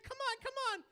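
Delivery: high mock mom voice; proud mom. Comic imitating his mom cheering him on, high squeal. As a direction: squeal like an excited mother. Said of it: "She's like".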